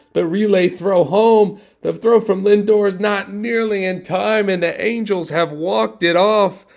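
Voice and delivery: high, loud, building to a stretched shout; excited walk-off call. Acted. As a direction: scream the final play home.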